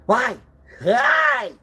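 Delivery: high, loud cries of why; shouted why. Acted. Demanding a reason, high shout. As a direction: cry out why twice.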